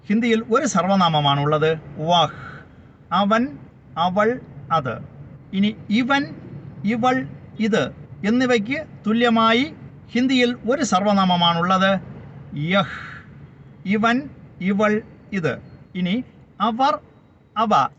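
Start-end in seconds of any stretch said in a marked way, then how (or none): 2.0-5.0 each pronoun spaced out slowly; deliberate teacher's recap; slow, careful listing. Shown as a demonstration. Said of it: "teacher enunciating pronoun meanings". 12.6-15.6 each pronoun spaced out slowly; deliberate teacher's recap; slow, careful listing. Shown as a demonstration. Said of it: "teacher enunciating pronoun meanings".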